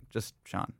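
casual ordering. Retold or imitated. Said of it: "I go".

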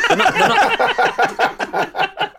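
high, slow laughing words; cracking up mid-sentence. Real, not acted.

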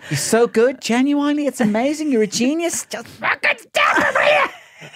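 gushing praise climbing to a screech; Miriam. Retold or imitated. Imitating a presenter's double-edged texts, gushing then screeching. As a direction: start high and loud, build to a shrieked plea. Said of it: "she's almost telling you".

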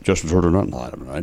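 lightly done tipsy announcer, low; Ross Porter. Acted. Host tests Justin Turner in the bit, low drunk announcer. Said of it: "a Ross Porter impression".

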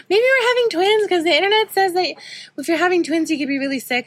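high, put-on husband voice, eager; imitating husband. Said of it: "he's like".

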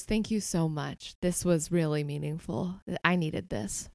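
soft, slow and heartfelt; Penny thanking Crusoe, soft and sincere; sincere thanks. Acted. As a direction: thank him quietly, meaning it. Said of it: "Penny turns back to Crusoe and just goes".

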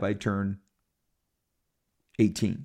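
ordinary talk, nothing marked

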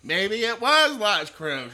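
high, loud, drawn-out character voice; Carlton Blanchard. Acted. Host quoting a sitcom character for laughs, loud impression. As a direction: stretch it out slowly, way up high and loud. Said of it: "for those of you who don't remember Carlton Blanchard".

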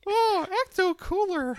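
high squeaky cartoon mouse voice, laughing; Mickey Mouse Peterson. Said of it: "making my Jordan Peterson sound like Mickey Mouse".

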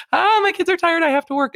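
high, loud frazzled complaint; frazzled parent. Retold or imitated. Tired millennial parent, high and exasperated. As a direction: whine like an overwhelmed parent. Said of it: "when you're like".

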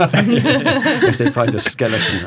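high, slow, loud laughing line. Real, not acted. Host joking about a skeleton on a lochside, laughing. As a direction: squeal it out mid-laugh.

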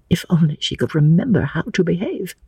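ordinary delivery

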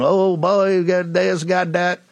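drawn-out mock Cajun imitation; mock Cajun accent. Host mocking a political commentator from Louisiana, exaggerated Cajun accent. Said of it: "don't give me that Cajun accent".

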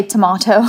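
"tomato" with a long AH, to-MAH-to; British tomato. Real, not acted. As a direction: say "tomato" as in England. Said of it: "so I was born in England".

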